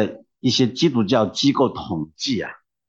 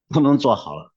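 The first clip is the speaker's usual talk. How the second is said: normal talking voice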